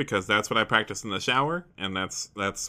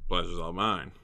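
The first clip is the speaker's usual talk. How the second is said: a light cowboy-tinged hero, a bit lower and softer; Harrison Ford voice; 6 semitones lower, 5 dB quieter than usual. Acted. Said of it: "I am doing a Harrison Ford voice".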